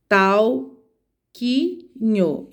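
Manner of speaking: "Tal, qui, nho" with a pause after each syllable. Shown as a demonstration. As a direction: break "Tal, qui, nho" into separate, evenly spaced syllables. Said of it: "Então, não são sílabas".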